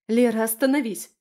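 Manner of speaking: loud, urgent command; exclaimed. A narrator's reading. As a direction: cry out to stop her. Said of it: "воскликнула я".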